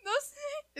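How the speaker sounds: high, crying little voice; retold tearful whimper. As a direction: imitate a choked childish sob. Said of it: "Y yo así de".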